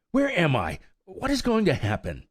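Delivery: put-on high, alarmed young colt; anxious cry. A narrator's reading. Captive colt asking old mare where he is, alarmed. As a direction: cry out, lost and frightened. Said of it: "cried Firefly".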